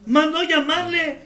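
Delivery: ordinary talk, nothing marked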